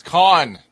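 "Con" for Cannes, one loud syllable with an ah; corrective pronunciation. Shown as a demonstration. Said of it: "I'm just saying it's called Cannes".

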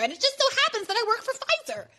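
sarcastic high-pitched liberal impression; mock liberal voice. Mocking liberal reasons against Bernie.

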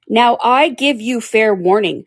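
loud, slow royal shout. Acted. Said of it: "shouted the Queen".

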